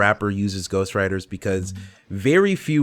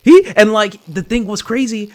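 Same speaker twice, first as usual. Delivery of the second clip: hyped-up shout, much higher and louder; bursting with excitement; amped; 11 semitones higher, 9 dB louder than usual. Real, not acted. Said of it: "I was amped though".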